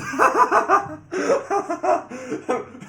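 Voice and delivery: loud, high-pitched laughing fit; helpless laugh. Real, not acted. Player cracking up at the AI's answer, high helpless laughter.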